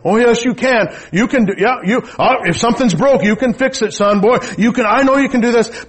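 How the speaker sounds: loud, high, fired-up fatherly urging; encouraging dad. Retold or imitated. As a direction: boom it out like a proud dad pushing his boy. Dad urging his doubting son.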